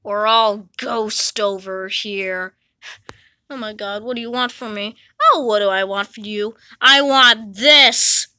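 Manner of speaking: creepy ghost. Acted.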